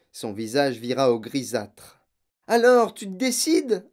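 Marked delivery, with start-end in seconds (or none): 2.5-3.9 high, loud, impatient prodding; insistent. A narrator's reading. Mother pushing her reluctant husband, insistent. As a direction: press him for action. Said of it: "insista la mère".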